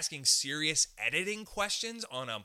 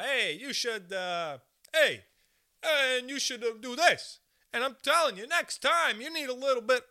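high, loud busybody voice; mock pushy advisers; 8 semitones higher, 4 dB louder than usual. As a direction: lecture with nagging insistence. Voicing pushy advice-givers.

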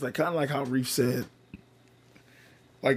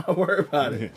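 quick, higher laughed echo; laughing; 5 semitones higher, 5 dB louder, faster than usual. Real, not acted. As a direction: toss it out louder through a laugh. Laughs while echoing the punchline.

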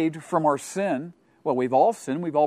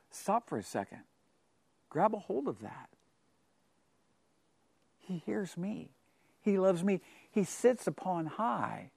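hushed; 11 dB quieter than usual. Real, not acted. Preacher hushing to let the congregation absorb it. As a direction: let it sink in softly. Hushed reflective aside to listeners.

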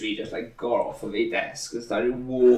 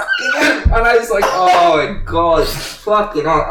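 shocked; 6 semitones higher, 6 dB louder than usual. Retold or imitated.

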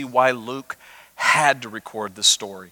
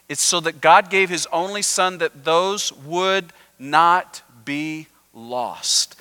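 usual speaking voice

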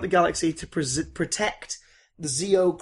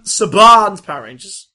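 announcer voice; 4 semitones higher, 12 dB louder than usual. Acted. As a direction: announce the movie title. Announcer title read.

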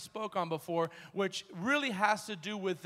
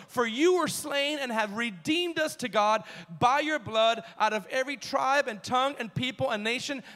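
raised, driving preacher's reading; forceful scripture reading; 4 semitones higher, 4 dB louder than usual. Acted. Preacher reads the new song to congregation, forceful reading. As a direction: read the verse out with building force.

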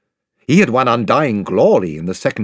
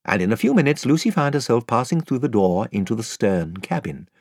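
usual speaking voice

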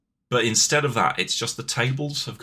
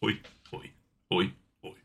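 clock bloke; 4 semitones lower, 7 dB quieter, faster than usual. Pretend. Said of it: "if it's nine o'clock, it'd be like".

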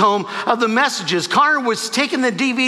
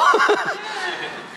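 high bright laugh; 12 semitones higher, 3 dB quieter than usual. Real, not acted. Laughs at the miracle.

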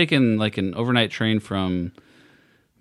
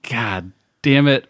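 mock exasperation; 6 semitones higher, 6 dB louder than usual. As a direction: lament it with hammed-up national shame. Retold dismay at being caught mocking.

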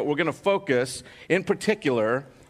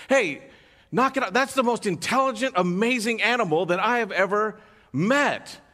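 high, raised protest; indignant butcher; 7 semitones higher than usual. Retold or imitated. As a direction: stop the owner scolding the dog. Butcher defends dog.

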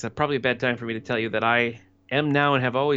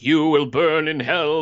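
mock fire-and-brimstone preacher; 3 semitones higher, 5 dB louder, slower than usual. Feared hellfire pastor in dream.

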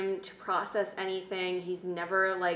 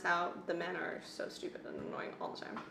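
hushed unvoiced whisper; whispered complaint about the men; whispered aside; 7 dB quieter than usual. Real, not acted. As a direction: whisper it like a confession.

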